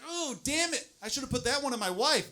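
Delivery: high-pitched mock regret; regretful. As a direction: lament a wasted chance, hammed up. Acting out comic regret.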